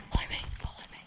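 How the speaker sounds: hushed whisper, urging them on. Real, not acted. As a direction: whisper so nobody hears. Sneaking into abandoned place.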